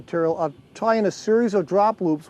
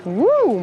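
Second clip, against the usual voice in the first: excited whoop; 5 semitones higher, 4 dB louder than usual. Real, not acted. Fighting a fish.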